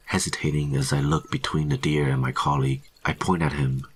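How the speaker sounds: ordinary delivery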